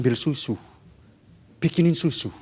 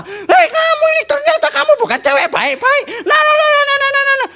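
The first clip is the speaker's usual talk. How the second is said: angry scolding. Acted. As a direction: scold the wife in a shrill rage. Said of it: "langsung marah-marah". (kakek memarahi nenek dengan suara tinggi melengking)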